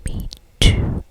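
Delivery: usual speaking voice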